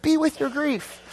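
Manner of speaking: high-pitched, pleading exasperation; exasperated insistence. Acted. Preacher wanting to shake apologetic mourners, exasperated. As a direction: plead as if shaking some sense into them. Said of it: "I wanna shake people".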